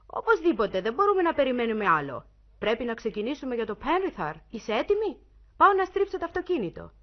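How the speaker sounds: loud, high, urgent calling. A narrator's reading. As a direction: keep shouting up to your wife, pitched high. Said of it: "ο σύζυγός της συνέχισε".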